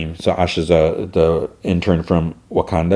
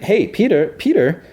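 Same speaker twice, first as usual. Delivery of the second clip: high, sing-song call to a nephew sneaking past; aunt calling out; 12 semitones higher than usual. Retold or imitated. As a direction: call his name up high, playfully. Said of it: "And she's like".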